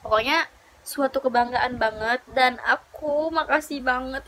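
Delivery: ordinary talk, nothing marked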